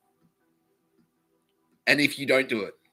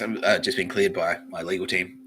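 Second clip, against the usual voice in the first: ordinary talk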